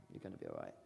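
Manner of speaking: whisper-close comfort; gentle, low reassurance; soft reassurance. Shown as a demonstration. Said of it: "repeated softer".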